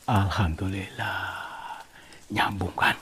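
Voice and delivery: soft, unhurried aside; gentle relief. Real, not acted. Explorer pleased the connection works, soft and gentle. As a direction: let it out quietly, as if not to disturb.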